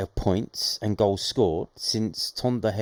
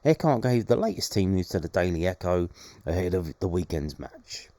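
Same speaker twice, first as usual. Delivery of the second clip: ordinary talk, nothing marked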